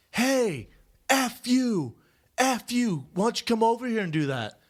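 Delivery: high angry yell through wall. Retold or imitated. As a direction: yell it like a furious neighbor. Angry neighbor yelling through wall.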